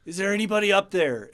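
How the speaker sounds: high, loud call up to the heavens; hopeful yell skyward; calling to the sky. Retold or imitated. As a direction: shout upward, hoping someone answers. Hiker calls up to heavens.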